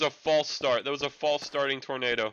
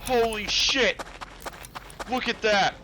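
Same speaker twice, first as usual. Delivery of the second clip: excited shouting; 6 semitones higher than usual. Acted. Tornado touches down, calling it in.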